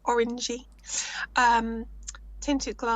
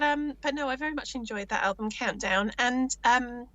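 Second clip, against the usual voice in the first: usual speaking voice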